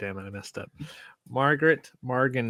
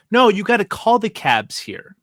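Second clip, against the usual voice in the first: high, loud insistent lady; hammed-up bossy lady; imitated film lady; 9 semitones higher, 7 dB louder than usual. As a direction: put on a raised, higher voice and insist.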